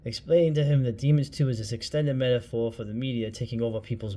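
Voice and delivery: ordinary delivery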